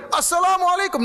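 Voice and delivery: high, loud salam called out to the statue; loud shouted greeting. Retold or imitated. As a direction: shout the greeting at the idol on purpose. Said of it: "dengan suara yang keras". (salam diteriakkan keras dan tinggi)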